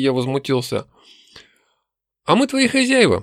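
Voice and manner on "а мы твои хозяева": high-pitched elf explaining who rules; much higher voice, explaining to a captive; elf captor. A narrator's reading. Elf telling the captive who owns him, high-pitched. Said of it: "пояснил ушастый".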